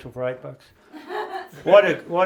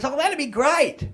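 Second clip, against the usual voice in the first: high, slow, lightly voiced mum; a high, drawn-out thrilled mother; mum's pleased voice; 10 semitones higher, slower than usual. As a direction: play the delighted old mum. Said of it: "She goes".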